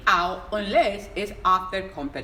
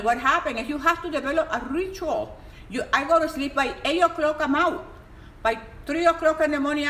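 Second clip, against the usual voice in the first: normal talking voice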